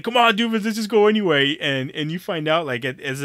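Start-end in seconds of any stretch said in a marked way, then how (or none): 0.0-1.6 higher, louder mock Frank voice; imitating Frank. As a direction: toss it off like Frank egging his buddy on. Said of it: "Frank's like".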